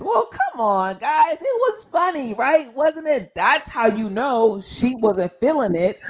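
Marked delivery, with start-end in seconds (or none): 0.0-3.3 high-pitched mock pleading with the crowd; imitating a comedian. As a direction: impersonate a comic coaxing an audience, pitched up. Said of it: "But then Chris says".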